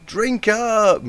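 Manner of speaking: high, loud party call; loud cry. A narrator's reading. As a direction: shout it out gleefully. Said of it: "the mare cried again".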